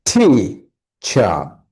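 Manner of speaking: "টি, চা" split in two, second part dropping low; first-syllable stress. Shown as a demonstration. Teacher breaking the word for students, stressing "টি". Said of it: "the stressed syllables".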